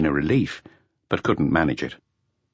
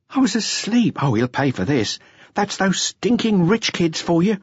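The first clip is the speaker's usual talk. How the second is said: higher, resentful woman's voice, slowing at the end; indignant dancer; 12 semitones higher than usual. A narrator's reading. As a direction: spit out the grievance in her voice. Narrator voices Adele, indignant.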